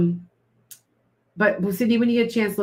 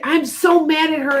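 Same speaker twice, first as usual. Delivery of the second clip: mock angry rant; 8 semitones higher, 5 dB louder than usual. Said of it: "you get".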